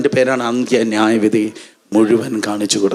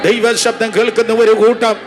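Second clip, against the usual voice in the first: insistent rhythmic declarations; fervent revival preacher; full-force prayer leading; 7 semitones higher than usual. Real, not acted. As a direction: urge the congregation on with repeated phrases. Leads congregation in prayer.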